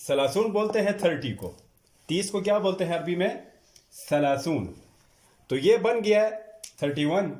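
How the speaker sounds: ordinary talk, nothing marked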